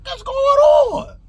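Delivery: very high, loud, incredulous cry; tripping out. Retold or imitated. Man facing a stairwell of police, tripping out. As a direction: let it soar in total bewilderment. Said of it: "I'm tripping out now".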